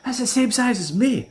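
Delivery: high, fast reassuring squeal; mocking Stevie. Stevie reassuring the narrator, high fast mock voice. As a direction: rush it high and chummy. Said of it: "And he's like".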